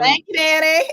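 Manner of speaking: high, loud, playful call-out; exuberant thanks. Real, not acted. Host thanking helper for finding it, exuberant call. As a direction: call out thanks, about to laugh.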